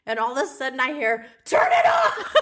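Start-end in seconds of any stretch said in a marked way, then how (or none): 1.5-2.4 high, loud imitation of an angry husband; mock angry yell. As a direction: yell it like someone jolted awake. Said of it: "We're yelling at each other".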